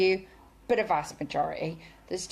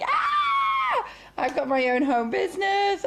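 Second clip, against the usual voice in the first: high squeal of joy, then eager; a thrilled newcomer bursting to tell everyone; excited new consultant; 11 semitones higher, 3 dB louder than usual. Retold or imitated. New consultant imitated squealing over her own home business. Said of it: "You're really, really excited".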